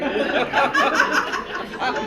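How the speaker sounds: loud laugh tumbling down; hearty laugh. Real, not acted. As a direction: laugh it off, amused. Laughing at the fake mic.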